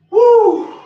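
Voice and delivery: loud high cheer; excited whoop. Real, not acted. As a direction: whoop it out, pumped. Whoop after finishing set.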